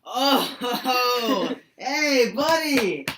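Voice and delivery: loud, high-pitched, hammed-up delight; excited greeting. Emerson surprised by his old friend Thoreau, excited greeting. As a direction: holler a hello to a long-lost pal.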